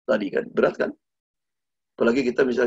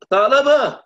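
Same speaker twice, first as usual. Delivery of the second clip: far higher, loud drawn-out call; Sayyidina Ali calling; 14 semitones higher, 9 dB louder, slower than usual. Acted. Ali calling Tha'labah from afar, acted shout. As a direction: holler the name up a hillside. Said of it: "Dipanggil sama Sayyidina Ali".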